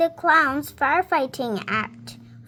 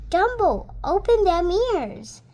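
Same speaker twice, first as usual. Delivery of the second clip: urgent Timothy; 4 semitones higher than usual. Acted. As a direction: shout encouragement as they fall. Timothy urging Dumbo mid-fall.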